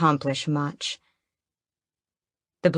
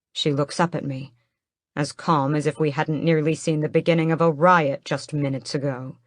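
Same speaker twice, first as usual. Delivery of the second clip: plain reading voice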